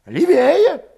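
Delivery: high, loud urgent character voice; agitated villager. A narrator's reading. Urging his comrade to leave the village, agitated.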